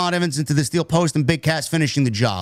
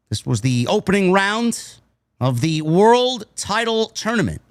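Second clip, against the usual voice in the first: usual speaking voice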